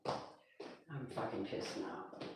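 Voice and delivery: slow, hushed, breathy fury under the breath; real pissed-off whisper, slow and voiceless; seething whisper. Real, not acted. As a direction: whisper your anger to yourself. Seething aside after argument.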